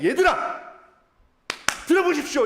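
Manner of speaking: indignant Peter. Retold or imitated. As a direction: rise up and address the crowd forcefully. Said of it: "모욕하는 걸 견딜 수가". (분개하여 크게 외치는 설교)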